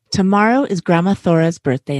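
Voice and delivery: ordinary delivery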